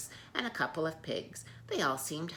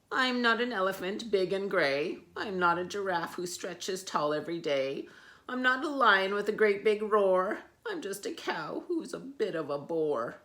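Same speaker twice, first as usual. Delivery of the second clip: higher, loud singsong cow; rhyming cow lament; 5 semitones higher, 7 dB louder than usual. Acted. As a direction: recite her woes. Cow lists woes.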